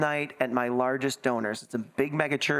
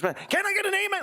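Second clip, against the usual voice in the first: high preacher's plea for an amen; a squeaky hammed-up call for an amen; mock preacher. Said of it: "you're just like".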